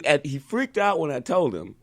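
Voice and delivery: ordinary talk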